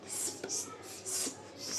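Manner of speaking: voiceless "स-" hiss, very soft; just hiss "स-" like in sip; unvoiced "स" hiss; hissed s sound. Shown as a demonstration. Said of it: "ऐसे इसका sound होगा".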